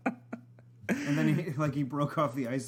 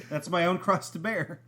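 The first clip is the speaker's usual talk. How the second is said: ordinary delivery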